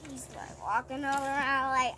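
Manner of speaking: ordinary delivery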